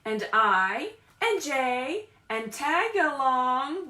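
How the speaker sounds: loud, slow, sing-song storybook read; reading to children. Acted. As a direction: read it big for the little ones. Reads picture book aloud.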